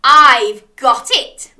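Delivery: loud, drawn-out eureka; beaming. A narrator's reading. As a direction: announce the bright idea as the crab. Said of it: "beamed Crab".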